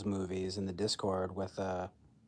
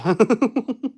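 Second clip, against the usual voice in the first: high, loud laugh; a short high burst of laughter; 12 semitones higher, 11 dB louder than usual. Real, not acted. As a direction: let a laugh escape. Laughing at self.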